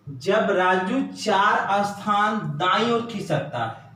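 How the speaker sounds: reads the question slowly, word by word; slow reading. Shown as a demonstration. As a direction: spell the question out for the class. (प्रश्न धीरे-धीरे पढ़ना)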